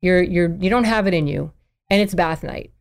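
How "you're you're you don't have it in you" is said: ordinary talk, nothing marked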